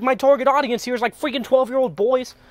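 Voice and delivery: ordinary talk, nothing marked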